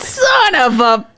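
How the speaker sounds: high, loud, drawn-out curse; mock dismayed curse. Worker seeing the same lady, mock dismay. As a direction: stretch it out in exasperation.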